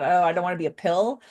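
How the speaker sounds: ordinary delivery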